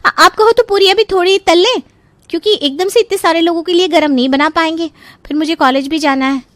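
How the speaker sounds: put-on high, lively young voice; chatty, eager sister-in-law; helpful sister-in-law.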